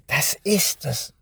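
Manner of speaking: slow, hushed burst of a sudden idea; excited whisper. A narrator's reading. Boy whispering to himself, hushed and thrilled. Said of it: "flüsterte Benjamin, von seinem eigenen Einfall begeistert".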